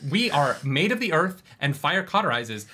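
ordinary talk

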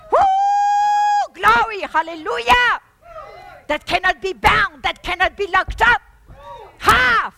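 very loud, high whoops; ecstatic shouting praise. Real, not acted. Preacher erupting in praise before the congregation, shouting. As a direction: shout for joy.

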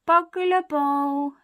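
put-on high, very slow baby babble. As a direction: repeat it like a toddler learning words, long and loud. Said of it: "says the baby".

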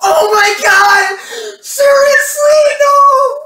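high, loud disgusted shriek. Real, not acted. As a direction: shriek like it's the worst taste ever. Real shock at a gross bean.

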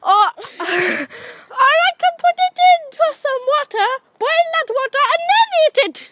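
high, quick hammed-up plan; acting out his thought. Retelling what he planned to do with the frog, high and quick. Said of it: "I thought".

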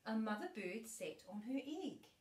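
gentle storybook reading voice; reading to a child. Acted. Grandma reading a bedtime book to her granddaughter, gentle storybook voice.

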